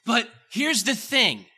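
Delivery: loud, high, driving sermon delivery; full-force preaching. Acted. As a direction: preach it hard to the congregation.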